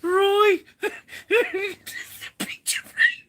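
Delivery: high squeaky words through helpless laughter; laughing hysterically. Real, not acted. Vlogger repeating the punchline to viewers, crying with laughter. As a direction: barely get the words out for laughing. Cracking up while repeating joke.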